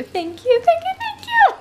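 squealed high thank yous; gushing thanks. Real, not acted. Mom thanking friend Ellie, squealing high. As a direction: squeal it out with delight.

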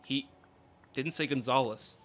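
usual speaking voice

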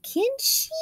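squeaky high tag question; playful high question. Real, not acted. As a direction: pop it up high.

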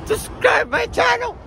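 high shouted plug; shouting. Acted.

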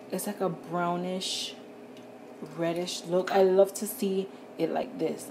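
usual speaking voice

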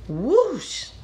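loud, high swooping whoosh; dramatic storybook whoosh; whoosh sound effect. A narrator's reading. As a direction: swoop up and down like rushing water. Narrator imitates rush of water.

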